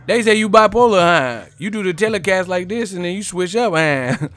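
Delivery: loud, high taunting shout; loud mocking heckle. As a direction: yell it to mock him. Heckling the reporter.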